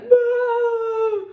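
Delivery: high, loud sobbing "No!"; crying no. Retold or imitated. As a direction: wail it through tears. Bawling in the salon.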